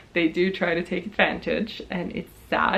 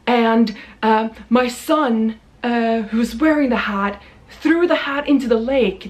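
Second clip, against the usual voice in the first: a hint of a surly, entitled man; lightly voiced sullen demanding guy; mild mock rude customer; 3 semitones higher, 3 dB louder than usual. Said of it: "he has a terrible tone".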